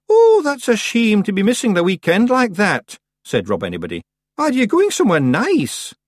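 high, loud little Scots man; cheery Feegle. A narrator's reading. Feegle chief cheerily consoling the dying witch. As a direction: chirp it out, tactless and cheerful.

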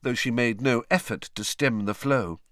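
ordinary delivery, nothing marked

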